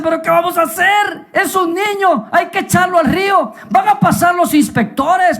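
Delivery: high-pitched urgent cry; panicked mother. Acted. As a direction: fret aloud in a high, alarmed voice. Mother panics over newborn son.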